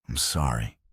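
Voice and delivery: low hoarse apology; rough, worn-out voice. A narrator's reading. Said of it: "he said hoarsely".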